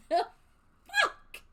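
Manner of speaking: high shocked squeak; shocked squeal. Retold or imitated. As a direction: shriek in disbelief. Shocked reaction.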